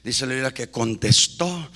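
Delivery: low, soft, near whisper; hushed close. Acted. Preacher closes softly, almost whispering to the church. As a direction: breathe the words to them.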